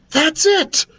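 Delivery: high-pitched burst of excitement; excited princess voice; excited shout. A narrator's reading. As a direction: exclaim it with sudden inspiration. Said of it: "Princess Pulverizer shouted excitedly".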